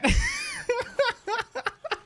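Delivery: high squealing laugh. Real, not acted. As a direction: let out a rising laugh. Laughing at own story.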